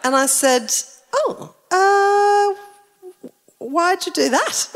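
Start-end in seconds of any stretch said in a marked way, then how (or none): 1.1-4.7 high, hesitant questioning of a dubious choice; baffled retold reaction. Wife questioning husband's gnome purchase, baffled. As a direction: stretch out a doubtful "oh, uh", pitched up. Retelling puzzled reply to doorstop purchase.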